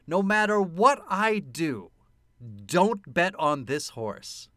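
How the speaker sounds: slow, measured, word by word; deliberate repeat-back. Retold or imitated. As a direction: spell out the warning slowly to check it. Slowly repeats the warning back.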